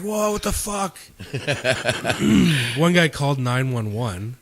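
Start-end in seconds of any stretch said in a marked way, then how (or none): 0.0-1.0 high startled what-the-hell cry; shocked outburst. Retold or imitated. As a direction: blurt it out, caught off guard. Guy shocked his beer taken.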